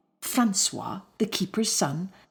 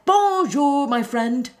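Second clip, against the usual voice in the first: cheerful greeting; 6 semitones higher, 4 dB louder, slower than usual. Acted. Lion greets squirrel.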